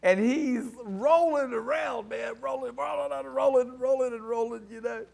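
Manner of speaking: high, insistent, repeating roll of words; excited retelling. Retold or imitated. Man telling of the preacher rolling around, excited.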